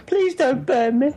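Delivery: high, pleading mock plea; squeaky mock plea from the old woman. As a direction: beg not to be burnt, hammed up. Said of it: "she'd be like".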